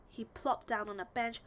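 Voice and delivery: ordinary delivery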